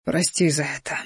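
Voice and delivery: soft, hushed murmur; quiet, breathy apology; whispered apology. A narrator's reading. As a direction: whisper it into an ear. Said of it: "прошептал на ушко".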